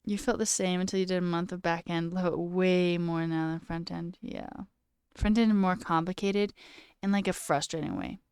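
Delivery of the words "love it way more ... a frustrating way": usual speaking voice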